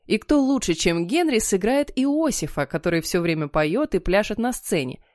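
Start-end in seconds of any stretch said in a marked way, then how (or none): none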